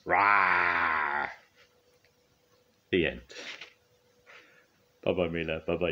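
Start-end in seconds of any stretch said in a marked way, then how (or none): 0.1-1.3 long mock roar; drawn-out storybook monster "rah"; pretend dinosaur roar. A narrator's reading. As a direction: roar playfully like a mighty monster. Muck plays the mighty Muckosaurus.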